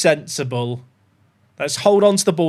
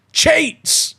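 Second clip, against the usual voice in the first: indignant cry; 10 semitones higher than usual. Real, not acted. Goal ruled out.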